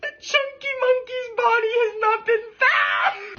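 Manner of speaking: high, slowed, cracking with laughter; laughing through words. Real, not acted. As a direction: try to read while losing it. Breaks up reading the news.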